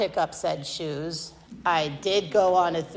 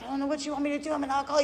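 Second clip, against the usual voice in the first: retold yelling back; 6 semitones higher, 4 dB quieter, much faster than usual. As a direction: replay the outburst high and softer. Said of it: "I'm yelling back".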